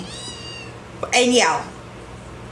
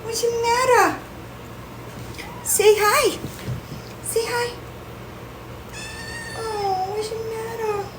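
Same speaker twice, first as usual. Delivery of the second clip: high, softer pet voice; 12 semitones higher, 6 dB quieter than usual. Real, not acted. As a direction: hush and coo to the cat. Cooing to the cat.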